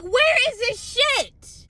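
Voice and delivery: high, slow, disbelieving cry; exasperated search. Retold or imitated. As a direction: pitch it way up and drag it out in disbelief. Searching shelves for the drug.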